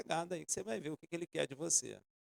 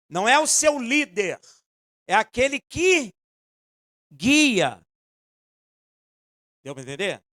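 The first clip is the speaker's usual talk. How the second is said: loud, slow, emphatic sermon delivery; preaching with force; full-force preaching; 2 semitones higher, 11 dB louder, slower than usual. Acted. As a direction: drive each phrase home to the congregation.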